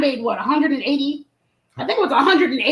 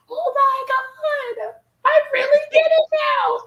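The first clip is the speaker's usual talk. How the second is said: much higher, slow, overjoyed shout; ecstatic cry; 12 semitones higher, slower than usual. Retold or imitated. The guest tasting the margarita, ecstatic high cry. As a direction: cry out in delight.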